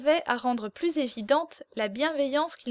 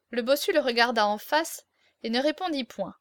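plain reading voice

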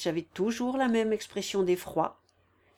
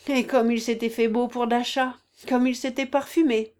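raised, bitter reproach; menacing; 4 semitones higher, 6 dB louder than usual. A narrator's reading. Woman suddenly recalls his finery for Dasha, menacing. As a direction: throw it at him louder, with threat. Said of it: "d'une voix sourde mais menaçante".

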